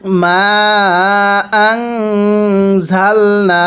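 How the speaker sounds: very slow chant, nasal hum held on the nun in আংজালনা; recited with ghunnah. Shown as a demonstration. Teacher showing students the ghunnah, slow chant. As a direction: draw out মা for three alifs, then hum the nun. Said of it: "তাহলে".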